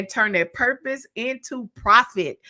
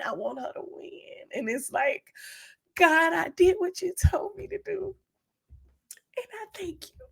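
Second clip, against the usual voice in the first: choked up; 10 semitones higher than usual. Real, not acted. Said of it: "I want you to feel that from my heart".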